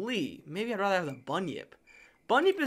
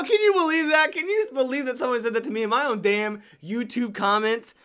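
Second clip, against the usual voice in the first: higher, laughing indignation; mock outrage; 5 semitones higher, 5 dB louder than usual. Streamer recounts a viewer's comment, mock outraged. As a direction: act scandalized through a laugh.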